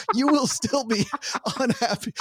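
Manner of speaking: high-pitched, laughing repeat of a punchline; can't get it out without laughing; laughing through words. Real, not acted. Retelling the tour guide's caveat, laughing through it.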